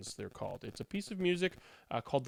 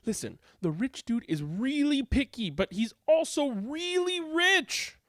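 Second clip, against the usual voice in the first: coaxing Goldberg; 8 semitones higher than usual. A narrator's reading. Goldberg persuades Bach.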